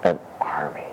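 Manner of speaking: hushed reveal; whisper. Acted. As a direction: breathe it like a secret. Hushed answer to his question.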